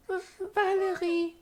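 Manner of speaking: very high, eager cry of a name; high character call. Acted. High character calling Valerie.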